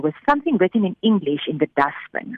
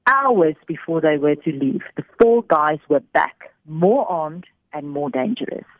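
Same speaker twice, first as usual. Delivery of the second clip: usual speaking voice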